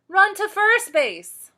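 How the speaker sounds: high loud call, a little slow; yelling boys. Acted. Boys calling to her from the bench, loud yell. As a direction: shout the direction across the field. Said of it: "yelled the boys".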